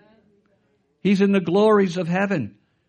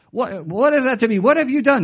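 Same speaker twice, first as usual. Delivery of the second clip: higher, louder impatient demand; impatient Pilate; 7 semitones higher, 5 dB louder than usual. Retold or imitated. Pastor voicing Pilate losing patience with Jesus, raised impatient voice. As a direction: snap the question at the prisoner. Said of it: "He became very impatient".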